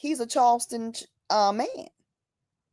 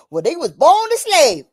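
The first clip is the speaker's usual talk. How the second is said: mock naive claim; 5 semitones higher, 8 dB louder than usual. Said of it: "people still talk about".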